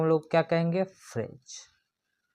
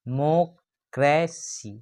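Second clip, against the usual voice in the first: Democracy slowly, डे - मो - क्रे - सी with gaps; four separate beats on democracy; split into syllables; much slower than usual. Shown as a demonstration. Teacher counting syllables for students, democracy split into four. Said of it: "democracy में four syllable है".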